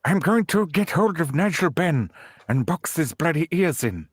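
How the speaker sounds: a Mandela attempt that comes out as Chris Eubank; lisping boxer voice with a Mandela try underneath; failed Mandela impression. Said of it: "when I tried to do Nelson Mandela, I get Chris Eubank".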